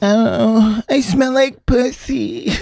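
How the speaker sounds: high, slow hammed-up posh voice; Jude Law impression. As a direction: ham up a vain actor. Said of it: "That's what he's like".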